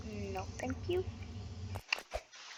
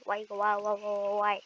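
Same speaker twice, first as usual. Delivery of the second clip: normal talking voice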